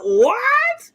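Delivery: shocked. Retold or imitated. Shocked reaction to gaming loss.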